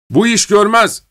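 high, loud angry roar; roaring sergeant. A narrator's reading. Sergeant roaring at the failed guillotine, angry roar. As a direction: bellow that it won't work. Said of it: "diye kükredi çavuş".

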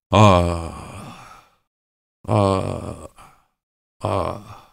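spaced-out groans, dying away; pained moaning. A narrator's reading. Dead commoner groaning in torment, fading moans.

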